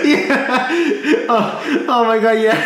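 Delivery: high-pitched laughing reaction; real laughter. Real, not acted. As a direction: agree while cracking up. Cracking up at recalled joke.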